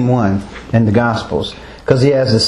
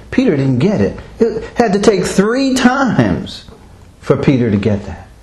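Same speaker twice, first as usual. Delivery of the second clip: normal talking voice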